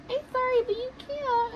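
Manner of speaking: high put-on voice. Woman refusing friend shelter, high imitated voice.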